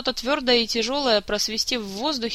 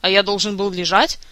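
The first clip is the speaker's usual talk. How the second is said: louder, irritated comeback; indignant retort; 5 dB louder than usual. A narrator's reading. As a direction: throw the question back crossly. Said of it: "продолжал разоряться Кантор".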